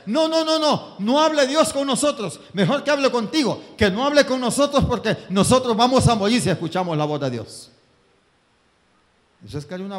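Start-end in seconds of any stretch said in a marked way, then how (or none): 0.1-7.7 high, loud, half-laughing pleas; frightened pleading. Acted. The people begging Moses not to let God speak, loudly. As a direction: beg them to keep God away. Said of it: "le dijo el pueblo a Moisés".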